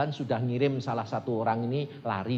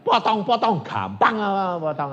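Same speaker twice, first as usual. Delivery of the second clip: loud, raised defiant retort; shouting back; 7 semitones higher, 9 dB louder than usual. Retold or imitated. Preacher shouts back at the armed mob, loud and defiant. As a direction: yell back at the threatening mob. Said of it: "Demikian saya teriak gitu".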